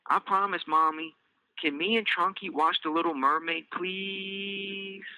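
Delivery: put-on high, sweet child voice asking for a cookie; little girl Elise. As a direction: play a small girl begging sweetly.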